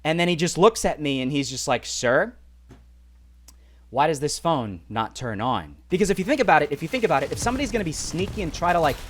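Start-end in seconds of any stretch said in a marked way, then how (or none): 1.9-5.8 slow, low mock interrogation; serious officer. Officer to traveler over dead phone, slow serious imitation. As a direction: imitate an officer, spacing out the question. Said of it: "he gets super serious".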